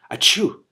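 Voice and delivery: loud sneeze sound; mock sneeze. Reader performing Roxim's sneeze, a loud achoo. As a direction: act out the sneeze.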